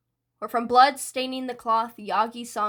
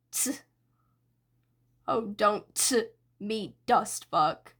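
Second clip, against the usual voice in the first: sneering; slower than usual. Acted. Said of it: "Dabi sneered at Shigaraki".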